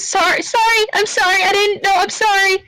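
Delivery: high, fast, tearful apology voice; a shocked, almost-crying Dragonborn; panicked apology. Acted. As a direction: blurt out sorry in a high squeak. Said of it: "just goes".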